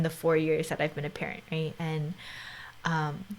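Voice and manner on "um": usual speaking voice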